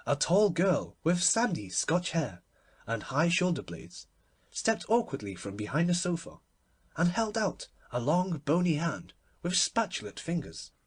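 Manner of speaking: ordinary delivery, nothing marked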